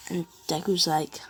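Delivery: ordinary delivery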